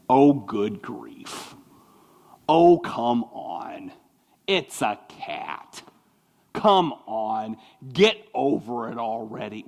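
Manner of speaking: slow, low, scornful sigh of a reply; dismissive eye-roll. Retold or imitated. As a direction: drawl it out like you can't be bothered. Mocking dismissive friend's reply.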